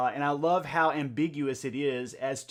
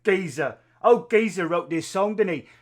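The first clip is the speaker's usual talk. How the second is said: loud, exaggerated Cockney accent; hammed-up Cockney geezer voice; 3 semitones higher, 6 dB louder than usual. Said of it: "thinking about it in a Cockney accent".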